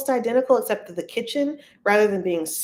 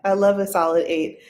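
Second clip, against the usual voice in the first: ordinary delivery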